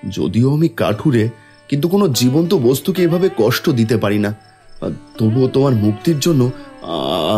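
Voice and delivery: ordinary talk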